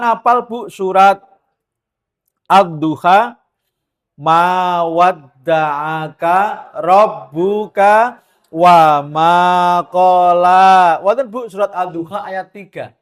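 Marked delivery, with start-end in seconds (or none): 4.2-11.0 slow, louder recited Arabic; Quran recitation. Acted. Preacher reciting Ad-Duha to comfort the women, slow and louder. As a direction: recite the verse slowly and loudly.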